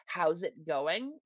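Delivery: slow, drawn-out rural greeting; slow rural drawl. Shown as a demonstration. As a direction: stretch the words out lazily. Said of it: "quick enough, or s-, fast enough".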